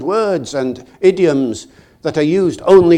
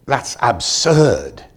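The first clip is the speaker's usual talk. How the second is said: very slow, dismissive; scoffing thought; much slower than usual. Retold or imitated. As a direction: drag it out in disbelief. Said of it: "I thought".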